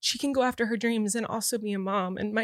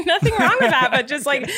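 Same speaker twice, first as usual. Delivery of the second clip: high, louder laugh into the words; 7 semitones higher, 6 dB louder than usual. Real, not acted. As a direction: laugh it out, amused. Laughs at the joke.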